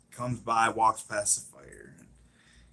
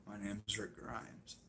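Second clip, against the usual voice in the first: imitated introduction, much softer; quiet introduction; 2 semitones lower, 22 dB quieter than usual. Acting Rick introducing himself calmly.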